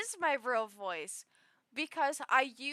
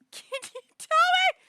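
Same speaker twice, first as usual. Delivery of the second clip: laughing; 13 dB louder than usual. Real, not acted.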